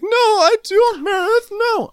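very high, loud mock wail; squealed falsetto denial, hammed up; Jen. Podcaster mimics Jen denying it to Meredith, squealing. Acting out Jen's shrieked denial.

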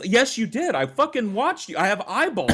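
retold shout back at the cheating sister; indignant retort. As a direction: insist you saw it, fed up. Arguing with sister.

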